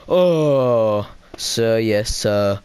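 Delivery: mock dejected troops, starting with a groan; kinda depressed. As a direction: sigh it out like let-down soldiers. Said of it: "in a kinda depressed voice".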